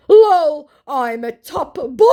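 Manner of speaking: slow, loud, high-pitched brag; mock boastful scammer. Scammer bragging to the baiter, mock boastful. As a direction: puff up the boast in a put-on voice.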